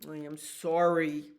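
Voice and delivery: loud, earnest dragon apology; sorry dragon. Acted. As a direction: own up plainly and firmly.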